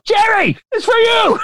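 very high-pitched imitated screech; Ann Mira scream. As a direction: scream to a husband in another room, imitating his wife. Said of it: "that awesome Ann Mira scream".

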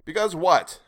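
high, loud, pressing; quick question. A narrator's reading. Sailor pressing the stranger, quick question. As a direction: jump in and demand the rest. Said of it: "I asked quickly".

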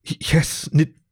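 halting, broken-off start of each word; stumbling. A narrator's reading. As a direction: trip over the words nervously. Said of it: "she stumbled".

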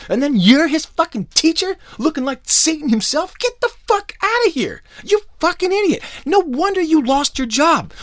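high, loud incredulous tirade; outraged shouting rant; angry rant. Real, not acted. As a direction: rant at full volume, voice pushed way up. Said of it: "A K A [name]'s rant hour".

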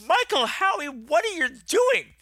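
high, drawn-out exasperated cry; hammed-up horrified "what on earth"; appalled mock scolding. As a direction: scold a friend in high-pitched disbelief. Retold horrified scolding of friend.

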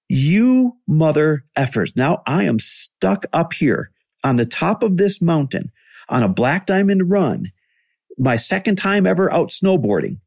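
slow, high-pitched indignant tirade; acting out a fit at friends who left; pissed-off retold rant. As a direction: draw out each phrase in exasperated mock fury. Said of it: "I'm pissed off and I'm throwing a fit".

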